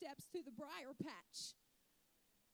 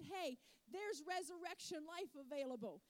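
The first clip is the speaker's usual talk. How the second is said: ordinary delivery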